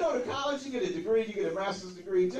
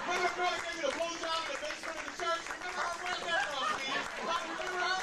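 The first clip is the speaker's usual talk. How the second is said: higher, sing-song mock sweetness on "sweetie"; mocking mimicry; 9 semitones higher than usual. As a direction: throw her words back in a syrupy, mocking voice.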